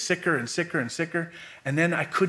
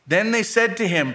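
measured Bible reading, phrases falling at the ends; solemn scripture read; 7 semitones higher than usual. Acted. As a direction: read the Word steadily to the church. Sailors question Jonah, higher.

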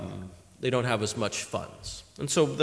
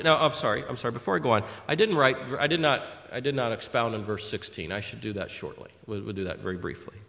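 usual speaking voice

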